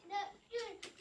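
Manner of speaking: normal talking voice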